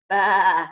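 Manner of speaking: loud mock bah; sheep bleat.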